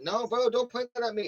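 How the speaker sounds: high, loud, alarmed "don't point that"; mock-panicked high cry of protest; panicked plea. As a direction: yelp it like a gun is on you. Friend points gun at him.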